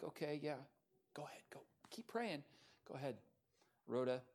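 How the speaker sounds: soft, half-whispered go-ahead; hushed reply. Retold or imitated. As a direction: wave her off in a whisper mid-prayer. Praying group answers Rhoda softly.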